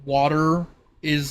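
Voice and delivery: normal talking voice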